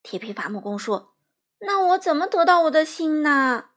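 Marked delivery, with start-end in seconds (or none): 1.6-3.7 high, loud, plaintive question; dismayed wail. A narrator's reading. Tin Woodman asking about his heart, loudly dismayed. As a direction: cry it out in dismay.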